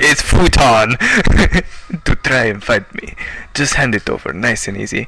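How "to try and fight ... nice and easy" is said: ordinary delivery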